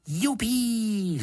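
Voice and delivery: put-on high, gleeful boy's cry; excited cheer. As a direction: cheer like a delighted schoolboy. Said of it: "zvolal Jonatán". (nadšené, vysoké zvolání)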